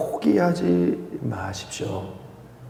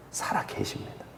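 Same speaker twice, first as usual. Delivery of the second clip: whisper; 12 dB quieter than usual. Acted. Hushed affirmation.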